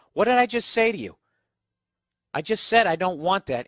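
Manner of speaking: indignant mock telling-off; retold indignation. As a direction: challenge the cashier, reenacting annoyance. Retelling his confrontation with a cashier.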